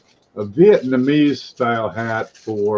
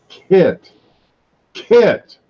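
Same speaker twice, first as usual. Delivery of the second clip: "kit" punched loud and high, then falling; "kit" set apart by pauses and emphasized like a sales pitch; stress on kit; 9 semitones higher, 6 dB louder than usual. Real, not acted. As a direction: hit "kit" hard as a word on its own. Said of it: "Emphasis on the word kit".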